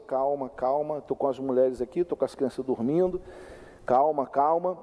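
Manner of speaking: low-pitched plea for calm; retelling himself calming down aggressors; acted pacifying. Acts out pacifying the drunk men. (pedindo calma, em voz mais grave)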